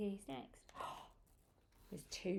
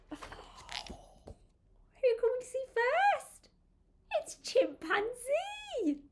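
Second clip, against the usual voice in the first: high, sing-out storybook excitement; excited reveal; 13 semitones higher than usual. Acted. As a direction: announce the animal with high-pitched delight. Revealing the first animal to children.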